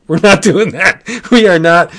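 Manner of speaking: laughing through a flat no; laughing refusal. Retold or imitated. Rick refusing the villain's puzzle, laughing. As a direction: blurt it out while cracking up.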